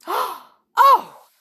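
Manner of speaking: high, loud outraged outburst; indignant cry. A narrator's reading. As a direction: gasp it out, stretching the words in outrage. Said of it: "cried Snarl".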